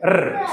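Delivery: ർ as a bare, high-pitched rolled sound; letter sound of R. Shown as a demonstration. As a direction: give just the sound ർ, not the letter name. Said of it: "ആറിന്റെ sound എന്താ?".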